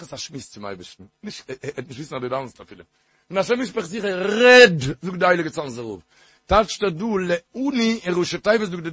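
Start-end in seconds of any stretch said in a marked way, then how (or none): 4.0-4.8 loud, drawn-out command; emphatic exhortation. Real, not acted. Preacher quoting a holy rabbi to his listeners, emphatic exhortation. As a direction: call it out at full voice.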